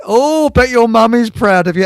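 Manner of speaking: high, loud sing-song mockery; mocking taunt. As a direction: jeer at him like a crowd of workmen. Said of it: "we were taking the piss".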